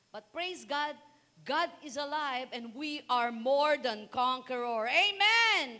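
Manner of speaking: rally the church to victory; full-force praise shout; triumphant preaching shout. Acted.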